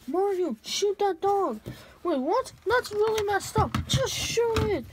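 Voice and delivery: arguing loudly over the dog; loud alarmed arguing. Acted. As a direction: yell the order over the chaos. Characters argue about shooting dog.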